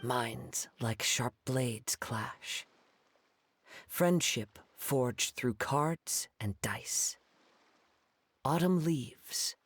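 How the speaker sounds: slow, measured verse, each line weighed; solemn poem recital. Acted. Swordsman recites his duel poem to Hu Tao, slow and solemn. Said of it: "Say your piece, Challenger".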